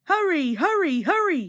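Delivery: chuffing along, playing at being grand; puffing hurry. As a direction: puff it out like a big grand engine. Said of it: "he puffed, pretending to be like Gordon".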